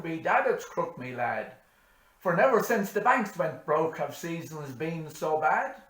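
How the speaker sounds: loud, high Irish farmer's grumble. Acted. Daniel Croke complaining about the banks, loud Irish grumble. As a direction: grumble in a Cork Irish lilt. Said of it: "said Daniel Croke".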